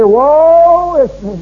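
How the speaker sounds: mock moaning complainer, drawn out; hammed-up lament. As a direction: drag out the woe like a whining martyr. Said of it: "riding old Job's horse".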